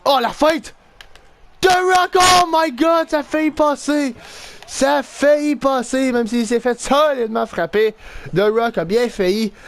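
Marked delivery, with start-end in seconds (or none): 0.0-7.9 high, thrilled outburst easing slightly; excited play-by-play. Real, not acted. Player watching The Rock's feint almost work, excited. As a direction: cry out at a near breakaway. The Rock nearly gets through.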